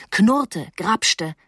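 plain reading voice

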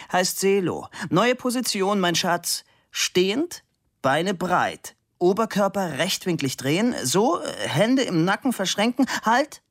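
high-pitched orders to a posing model; commanding sculptor. A narrator's reading. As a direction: direct the model through each position.

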